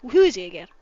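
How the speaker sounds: usual speaking voice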